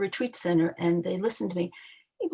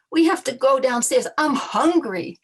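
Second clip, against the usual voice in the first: loud, high excited yell, last words slowed; 6 semitones higher, 7 dB louder than usual. Retold or imitated. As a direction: blurt it out hungry and giddy to the whole group. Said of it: "And I just yelled out".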